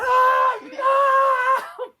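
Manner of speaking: very high loud shriek; girl's scream. Acted. Girl trapped by the blanket, very high loud scream. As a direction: cry out in terror.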